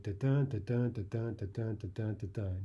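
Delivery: "da-dun" repeated evenly, stress on each "dun"; monotonous iambic rhythm. Shown as a demonstration. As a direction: drone "da-dun" on mechanically, weak then strong. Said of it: "start to get very monotonous".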